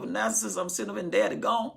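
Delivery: ordinary talk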